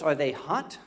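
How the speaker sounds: normal talking voice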